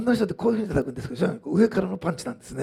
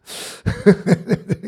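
loud burst of laughter; amused laugh; 3 semitones higher, 8 dB louder than usual. Real, not acted. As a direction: laugh out loud at your own story. Laughs at his own anecdote.

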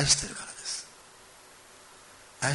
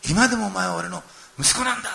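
louder and higher declaration; impassioned father; 5 semitones higher, 6 dB louder than usual. Retold or imitated. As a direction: proclaim it with conviction. (父が息子に力強く宣言する)

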